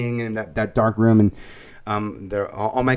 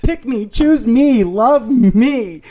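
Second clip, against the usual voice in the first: high, loud, slow begging; mock desperate pleading; 12 semitones higher, 7 dB louder, much slower than usual. Retelling wanting to be chosen, in mock desperate pleading. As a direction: beg at the top of the range, hammed up.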